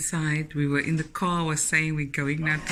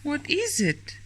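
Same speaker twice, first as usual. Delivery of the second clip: put-on baby talk; 10 semitones higher, slower than usual. Mother playing with toddler.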